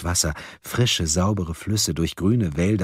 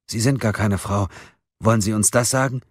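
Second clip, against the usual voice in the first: ordinary delivery, nothing marked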